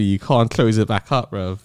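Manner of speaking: ordinary delivery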